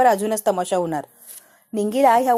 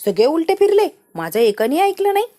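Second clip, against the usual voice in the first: raised, bitter shout at the servants; furious outburst; 6 semitones higher, 5 dB louder than usual. Acted. Kamini rages at servants who turned on her, shouting. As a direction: spit it out, betrayed and loud. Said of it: "आणि म्हणते".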